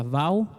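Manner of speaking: "Vau" said with a gliding au ending; "Vau", rhyming with Spanish "miau"; alternate pronunciation. Shown as a demonstration. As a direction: pronounce "Vau" as one syllable, no final consonant. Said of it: "algunas veces se pronuncia".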